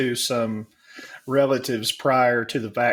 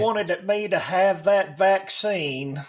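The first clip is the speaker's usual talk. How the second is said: higher, hammed-up Southern accent; fake Southern accent; 7 semitones higher than usual. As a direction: sound like a devout Southerner in a mock Southern accent. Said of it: "all three of us go into a fucking fake Southern accent".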